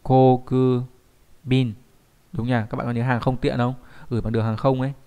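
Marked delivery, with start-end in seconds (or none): 0.0-1.7 very slow, syllable by syllable; slow model reading. Shown as a demonstration. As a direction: stretch each syllable out for learners. Teacher models the word slowly.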